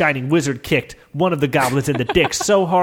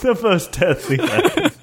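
higher, laughing repeat; 5 semitones higher than usual. Real, not acted. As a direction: giggle it out. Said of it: "echo of listener's line".

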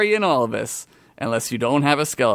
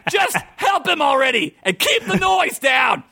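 high-pitched frantic shouting; screech-high rant building to a comic climax; hammed-up yell; 13 semitones higher than usual. A narrator's reading. Interrupted merc monk demands silence from below, yelling. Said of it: "he yelled".

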